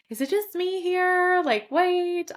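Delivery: high, drawn-out quoted question; puzzled self-questioning. Retold or imitated. Woman recalls doubting whether she belongs, puzzled. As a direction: voice your bewildered thought, pitched well up and slow.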